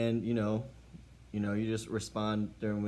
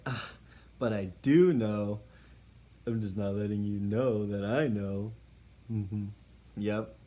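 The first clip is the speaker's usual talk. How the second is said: louder, slow, self-satisfied drawl; smug knowing; 3 dB louder, slower than usual. Acted. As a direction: savor that you're in on it. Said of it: "And you're just like".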